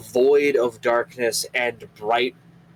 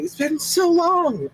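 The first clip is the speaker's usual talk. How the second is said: joyful reunion. Acted. Sisters hug Geshuo.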